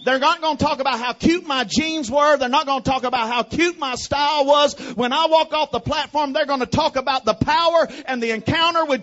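loud, rising, impassioned sermon shout; full-force preaching. Acted.

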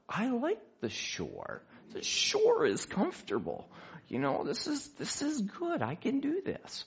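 high, slow, hammed-up reluctance; reluctant pleading. Man begging God to let him stay ashore, mock-reluctant pleading.

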